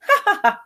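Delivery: short high chuckle; a quick amused laugh; grandmother's laugh. Acted. Grandmother laughing at the children's question. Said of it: "And she'd laugh".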